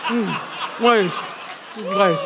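mumbling through a mouthful of candy; mouth full. Retold or imitated. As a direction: chew the words like a kid stuffed with candy. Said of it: "with a full mouth of candy".

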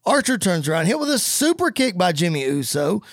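ordinary talk, nothing marked

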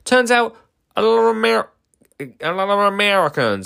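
a mock American twang, deliberately bad and over the top; bad American accent. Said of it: "that's my worst American accent".

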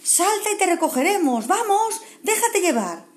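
high, coaxing encouragement; encouraging friends. Acted. Friends below urge Kevin to jump, coaxing. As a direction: coax him to jump. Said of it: "gritaban los amigos".

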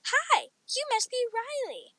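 lifted, cheery storybook greeting; joyful teacher. A narrator's reading. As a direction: greet a new pupil joyfully. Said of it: "my teacher said joyfully".

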